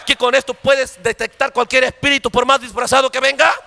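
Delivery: loud, rising fiery sermon; preaching at full force. Acted. Preacher exhorting the church, loud and fervent. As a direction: drive it home to the congregation.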